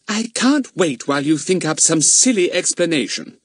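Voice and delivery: lightly voiced impatient mother; a touch of impatience, cutting him off; mother cuts off her son's excuse, impatient. A narrator's reading. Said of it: "said Missus Trottville impatiently".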